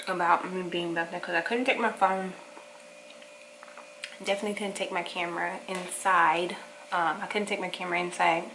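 muffled mouth full speech; talking while eating. Real, not acted. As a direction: hand covering mouth. Seen 0: Spoonful at -2; hand covers mouth while talking, repeatedly.

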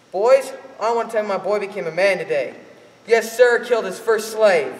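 loud, proud father bragging; father boasting to the men in the bar, loud. Acted. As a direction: announce it to the whole room.

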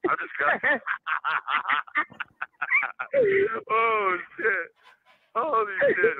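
high squealing laugh through words; laughing hard. Real, not acted. Man cracking up at co-host's crude joke, squealing laugh.

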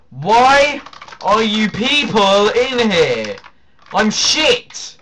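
high, loud, slow mock complaint; loud exclamation. Real, not acted. Mock outburst at clan chat.

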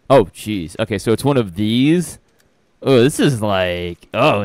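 usual speaking voice

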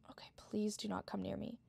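breathy pleading whisper. Real, not acted. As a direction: beg without making a sound. Hiding, whispering plea.

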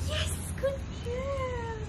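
very high, slow, sing-song praise; stretched-out, very high praise for the puppy. Real, not acted. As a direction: gush over the good dog in a squeaky voice. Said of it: "that specific tone".

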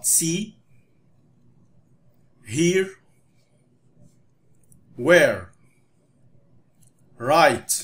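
each word said alone, clearly, with pauses; teacher modelling words for the class to repeat; slow model words for repeating. Shown as a demonstration. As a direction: lead the class, one word at a time. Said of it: "تعال نقولهم مع بعض".

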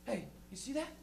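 cop talking to partner. Retold or imitated. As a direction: quietly point it out to your partner. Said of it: "they just look at a car".

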